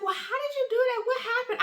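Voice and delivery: high-pitched, fast retold disbelief; high, quick astonished questions; incredulous questioning. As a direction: imitate a baffled friend, pitched right up. Owner reacting to her mistake.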